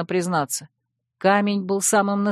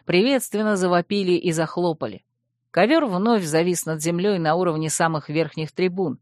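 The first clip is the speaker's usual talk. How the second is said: ordinary delivery, nothing marked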